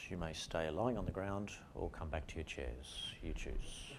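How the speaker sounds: soft, unhurried relaxation-guide voice; calm guidance. Acted. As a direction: ease the group gently out of the exercise. Closing a lying-down relaxation exercise.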